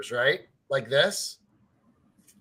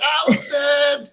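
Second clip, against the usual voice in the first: retold panicked yell; 12 semitones higher, 5 dB louder than usual. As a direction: call out to her in mock alarm, much higher. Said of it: "I'm like".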